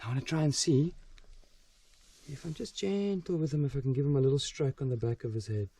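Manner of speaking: ordinary talk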